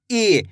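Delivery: long drawn-out ई sound; stretched ई. Shown as a demonstration. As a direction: hold the ई long and steady. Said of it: "खींच के पढ़ते हैं". (ई को खींचकर लंबा बोलें)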